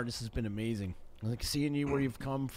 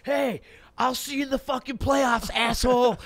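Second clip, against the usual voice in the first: loud, high heckling shout; hollered taunt, retold with gusto; imitated yelling; 11 semitones higher, 6 dB louder than usual. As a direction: mimic a buddy yelling abuse at strangers. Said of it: "he starts just yelling".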